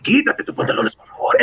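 low, breathy mock-seductive Spanish; Spanish seducer. Host flirting with a señorita on his Spanish podcast, mock-sultry. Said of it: "I was gonna speak Spanish".

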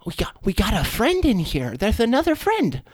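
very high, cheery squeak; squeaky fish creature. Acted. Fish creature greeting its bro friend, very high squeaky voice. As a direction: greet your buddy in a tiny high voice. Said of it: "looks over at the table and says".

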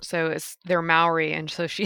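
usual speaking voice

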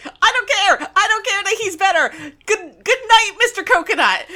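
hammed-up carefree taunt, laughing at the end; gleeful gloating. Wong taunting his rival, mock gleeful shout. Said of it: "He's like".

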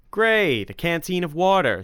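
sarcastic fake delight. A narrator's reading. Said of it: "said Tim sarcastically".

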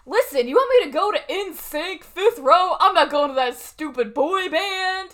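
loud, high, showy fake complaint; mock loud staged protest; talking really loud. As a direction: say it loud so someone across the lobby overhears. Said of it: "starts talking really loud".

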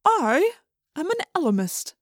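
high, deliberate voice of an ancient being; Ellimist. A narrator's reading. Being introduces himself to the children, stately announcement. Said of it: "speaking with an actual voice".

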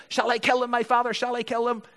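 high, urgent repeated question; excited king, read aloud; eager pleading. A narrator's reading. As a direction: ask it eagerly, pitched right up. King's eager question voiced in reading.

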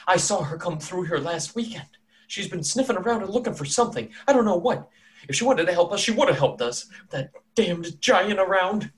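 high-pitched, foreboding townswoman; omen woman. Acted. Omen lady telling strangers what she saw, high and foreboding. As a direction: speak high as an uneasy village prophetess.

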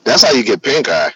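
blasting into the mic; too loud. Real, not acted. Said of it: "I'm very loud?".

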